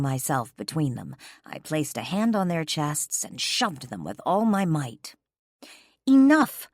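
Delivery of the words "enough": sharp, much louder and higher; loud warning outburst. A narrator's reading. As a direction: cut them off hard. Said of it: "I hissed warningly".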